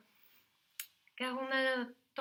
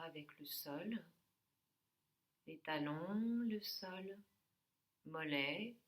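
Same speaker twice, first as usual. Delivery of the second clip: soft yoga nidra voice with long pauses; guided meditation; 5 dB quieter than usual. Real, not acted. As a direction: guide them gently through the body, phrase by phrase. Leads a yoga nidra session.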